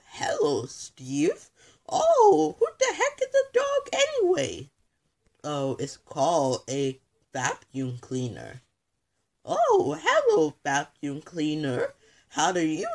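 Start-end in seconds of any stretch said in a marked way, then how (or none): 1.9-4.7 very high voice with a laugh in it; squeaky toy voice. Acted. Toy character laughs and asks about the dog in a squeak. 9.5-10.6 very high, loud little hello; squeaky toy voice. Acted. Toy chirps hello to the vacuum cleaner, very high.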